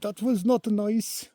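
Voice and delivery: high, loud mock German accent, drawn out; bad German accent. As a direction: imitate a startled German woman with a clumsy accent. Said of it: "or whatever her accent was. I'm not good at accents either".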